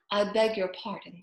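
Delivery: plain reading voice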